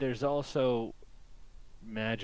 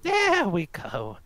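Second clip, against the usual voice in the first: put-on slow, high old-woman voice; 5 semitones higher, slower than usual. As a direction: play an old woman, drawing words out slowly. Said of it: "the old woman kinda just takes her cart and says".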